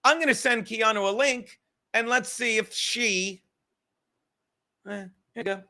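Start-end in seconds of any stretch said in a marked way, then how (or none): none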